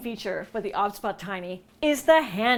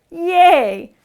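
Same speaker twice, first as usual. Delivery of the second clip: excited cheer; 7 semitones higher, 12 dB louder than usual. Real, not acted. Cheering camera zooming in.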